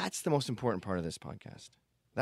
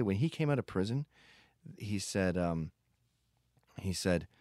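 normal talking voice

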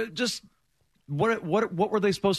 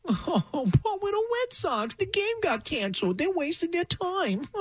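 high, slow crybaby whine; whiny baby voice; 12 semitones higher, 5 dB quieter, slower than usual. Retold or imitated. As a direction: sulk like a pouting little kid. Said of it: "that's how they sound".

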